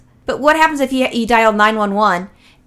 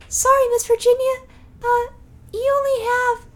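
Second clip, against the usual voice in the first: put-on little kid voice; 13 semitones higher than usual. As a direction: break bad news like a small child. Child doctor giving diagnosis.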